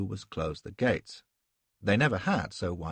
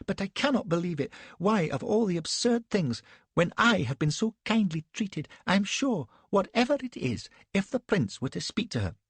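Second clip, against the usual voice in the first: much higher, prim ladylike voice; Elsbeth's protest; 11 semitones higher than usual. A narrator's reading. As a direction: protest with innocent certainty.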